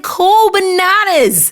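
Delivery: high, slow, drawn-out cool bananas; breezy fake-calm reply; cool and casual. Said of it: "And I was like".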